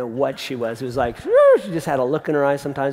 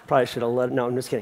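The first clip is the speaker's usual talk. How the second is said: ordinary talk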